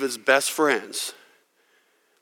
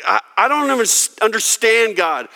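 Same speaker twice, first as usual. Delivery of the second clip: exasperated; 7 semitones higher than usual. Retold or imitated. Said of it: "And it's like".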